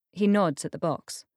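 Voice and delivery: ordinary delivery, nothing marked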